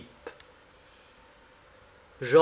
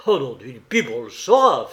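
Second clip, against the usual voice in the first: firm, louder oath; juron résolu; 3 semitones lower, 6 dB louder than usual. Acted. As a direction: swear it with determination. Jean jure, résolu à savoir. (juron ferme et décidé)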